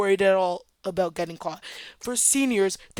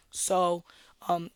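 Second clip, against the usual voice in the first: ordinary delivery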